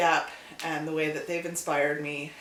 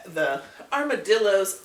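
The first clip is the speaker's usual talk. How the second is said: ordinary talk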